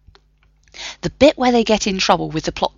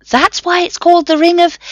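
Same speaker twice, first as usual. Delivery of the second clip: high, louder explanatory professor voice; fussy professor; 12 semitones higher, 7 dB louder than usual. A narrator's reading. Professor explaining the ring, high and fussy. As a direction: lecture it like a pedantic don.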